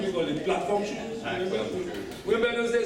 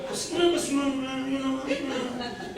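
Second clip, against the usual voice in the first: high-pitched imitation American street talk; put-on American accent; 8 semitones higher, slower than usual. Man confronting a stranger, hiding his Haitian accent in put-on American. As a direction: fake an American accent to mask your own. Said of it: "wouldn't pick up my accent".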